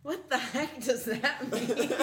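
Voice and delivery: mom voice. Retold or imitated. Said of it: "And she's like".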